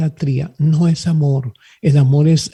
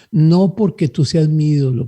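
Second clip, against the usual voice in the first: ordinary talk, nothing marked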